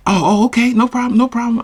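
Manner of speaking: high, rapid-fire mock acceptance; affronted brush-off. Rejected woman brushing him off, affronted and high. Retelling her reaction to rejection.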